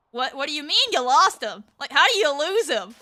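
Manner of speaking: loud, acted-out disbelief; mock incredulity. Band member to section leaders about lost kid, loud incredulity. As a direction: demand an explanation, loudly baffled.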